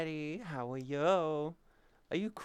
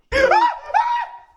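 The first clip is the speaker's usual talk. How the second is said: very high, loud burst of a laugh; startled screaming cackle; shrieking laugh; 15 dB louder than usual. Real, not acted.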